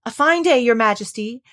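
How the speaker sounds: ordinary delivery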